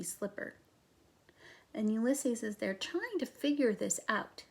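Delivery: soft unvoiced aside to viewers; reader aside. Real, not acted. Reader quietly shows listeners the pictures. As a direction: step out and murmur to the audience.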